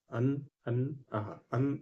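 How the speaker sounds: soft, drawn-out अन and आहा said as syllables; sounding out अन. Shown as a demonstration. Teacher to students, demonstrating अन softly. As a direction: say अन, अन slowly, feeling the nasal ending. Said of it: "जैसे मैंने बोला अन".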